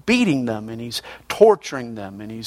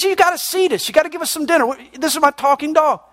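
insistent dog owner; 12 semitones higher, faster than usual. Acted.

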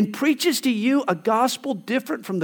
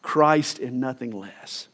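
quieter, lower; 8 semitones lower, 6 dB quieter, slower than usual. Shown as a demonstration. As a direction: drop lower and softer on the refrain. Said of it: "And a pure gospel".

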